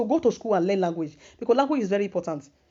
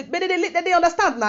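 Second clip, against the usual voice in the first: laughing retort; 8 semitones higher than usual. Retold or imitated. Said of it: "I say".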